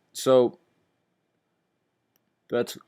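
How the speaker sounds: words stretched by a yawn. Real, not acted. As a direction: talk through a yawn. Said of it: "I keep yawning".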